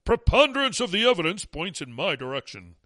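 lightly voiced mocking imitation; mocking Fauci. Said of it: "That's a big word for Fauci".

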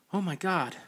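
high startled squeal. Acted. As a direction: squeal it out in sudden fright. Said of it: "Jade squealed".

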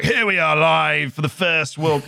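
hammed-up English match commentator going live; football announcer call. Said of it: "It was like".

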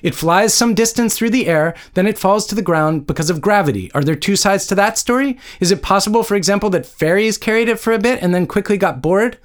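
high, sarcastic angry rant; contained narrated yelling; yelled. A narrator's reading. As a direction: argue it in exasperated disbelief. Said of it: "she yelled".

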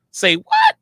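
very high, loud squeal of surprise; surprised squeal. Real, not acted. Friends checking Megan's Instagram, shocked squeal. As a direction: squeal it in disbelief.

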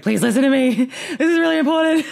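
mock-beg the listener to pay attention; imitating pleading author. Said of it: "you can hear it in the tone of his voice".

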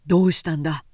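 a touch of concern, gruff; lightly voiced Tōkichi. A narrator's reading. Man's concerned question.

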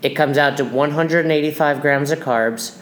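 ordinary delivery

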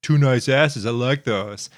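slow creepy catcall voice; mock catcaller. Guy in a Vine catcalls, slow and sleazy.

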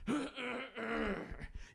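strained high throat clear. Real, not acted. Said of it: "You ever try to clear your throat".

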